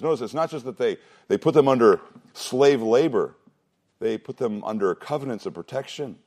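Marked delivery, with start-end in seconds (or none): none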